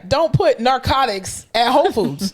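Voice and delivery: ordinary delivery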